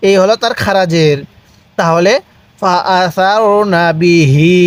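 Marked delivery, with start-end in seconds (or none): 2.6-4.5 "ফা আসারনা বিহি" drawn out very slowly, each vowel stretched; slow recitation demo. Shown as a demonstration. Teacher reciting a Quran phrase for students to repeat, very slow. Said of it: "পড়ুন".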